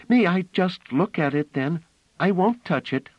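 put-on little girl pleading; eager child. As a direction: beg like a small excited girl.

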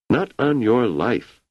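fervent. A narrator's reading. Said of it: "said Rach fervently".

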